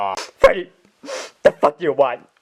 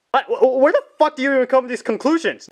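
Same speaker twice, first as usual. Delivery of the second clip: ordinary talk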